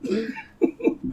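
very high, loud laughing words; can't hold it together reading the insult; laughing hard. Real, not acted. Cracks up at own insult.

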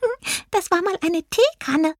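very high little mouse voice; tittering mouse. A narrator's reading. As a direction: squeak it with a hint of amusement. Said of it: "kicherte Cindy".

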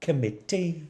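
high Welsh lilt, echoing a quote; Welsh accent. Shown as a demonstration. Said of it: "to say in a Welsh accent".

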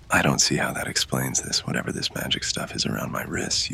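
usual speaking voice

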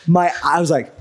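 ordinary talk, nothing marked

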